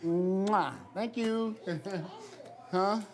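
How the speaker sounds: high, sing-song voice for toddlers. Real, not acted. Father talks to toddler daughters.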